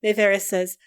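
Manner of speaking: ordinary talk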